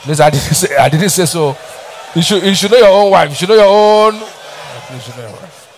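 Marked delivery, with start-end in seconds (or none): 2.1-4.1 louder, higher insistence; forceful preaching. Real, not acted. Preacher presses point hard. 4.1-5.7 much softer, half pace; hushed repeat. Real, not acted. Quiet slowed repetition.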